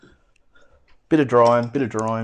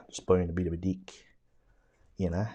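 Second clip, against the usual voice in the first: ordinary talk, nothing marked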